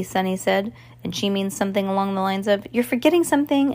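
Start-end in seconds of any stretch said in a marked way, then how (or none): 2.7-3.7 higher, pointed reminder; voiced character quote. A narrator's reading. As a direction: pitch it up for a child's reminder. Sunny's meaning voiced as a quote.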